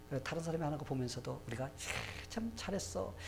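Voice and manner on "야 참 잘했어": impressed, demonstrative praise; admiring praise. Shown as a demonstration. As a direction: marvel at someone's work, rising in pitch. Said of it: "감탄을 하며 살게 되기를".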